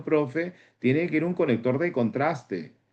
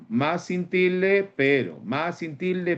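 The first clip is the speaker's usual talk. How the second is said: "más sin tilde" spoken slowly and higher, each word set apart; slow down and spell out "más sin tilde" for the class; más sin tilde stressed; 5 semitones higher, slower than usual. Shown as a demonstration. Teacher reminding students, slow deliberate "más sin tilde". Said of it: "te voy a hacer acordar".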